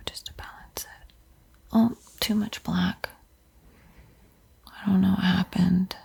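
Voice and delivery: ordinary delivery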